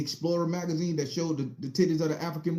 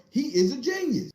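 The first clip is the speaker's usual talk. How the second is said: emphatic praise; 9 semitones higher than usual. Retold or imitated. Official praises boy.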